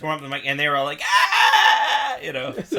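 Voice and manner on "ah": high, loud imitated roar; mock crowd cheer. Imitating Italian fans hearing opera, big mock cheer. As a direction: shout like a delighted audience.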